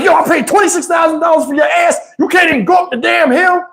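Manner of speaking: high, fast mock yell; cussing at the truck. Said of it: "I start cussing at the truck".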